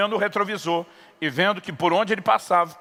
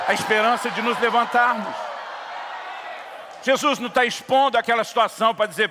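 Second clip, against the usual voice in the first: preaching at full force; 3 semitones higher than usual. Acted. Pastor to congregation about rising again, preaching at full force. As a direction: drive the hope home to the church.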